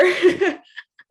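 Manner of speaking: a loud high laugh breaking out; bright burst of laughter. Real, not acted. Telling the interviewer her comeback, laughing loudly. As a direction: laugh out loud at your own punchline.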